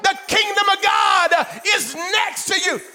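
loud, high, shouting preacher; fiery preaching. Acted. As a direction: preach it full force, building. Preaching at full force.

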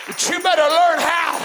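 high, loud exhortation from the pulpit; full-force preaching. Real, not acted. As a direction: plead with the congregation at a shout.

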